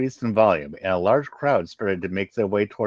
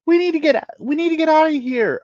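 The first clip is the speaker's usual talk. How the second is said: high, loud panicked yell; frantic yell; 7 dB louder than usual. Acted. Female Zitarak yelling at the crowd to flee. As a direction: scream to be let out. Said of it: "a female Zitarak yelled".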